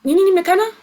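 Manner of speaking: "Ninyi ni McKenna?" high and rising on the end; question rise. Shown as a demonstration. Teacher to learners, Swahili question with a rising tune. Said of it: "as a question like".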